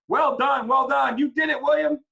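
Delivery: high, loud cheer; cheering crowd. A narrator's reading. Villagers cheering the boy, high and loud. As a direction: praise him with delight.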